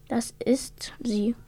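slow, soft, near-whispered joy; hushed delight. A narrator's reading. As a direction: whisper it in happy wonder. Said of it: "freute sich Otto".

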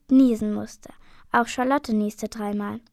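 ordinary delivery, nothing marked

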